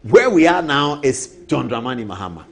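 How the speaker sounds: ordinary talk, nothing marked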